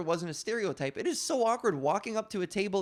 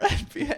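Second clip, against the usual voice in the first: high short laugh; laughing at the memory; burst of laughter; 11 semitones higher, 5 dB louder than usual. Real, not acted. Laughs at own story.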